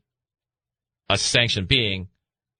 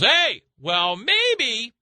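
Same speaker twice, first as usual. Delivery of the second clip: voicing Lucifer; 8 semitones higher than usual. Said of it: "Lucifer goes".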